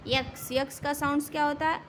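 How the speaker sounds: ordinary talk, nothing marked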